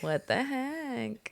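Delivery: high, soft, very slow "heck" as "haack"; imitating a shy, wrong-sounding "heck", drawn out like "hack"; mispronounced HECK. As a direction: stretch "heck" out into a quiet "hack". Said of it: "did not sound right".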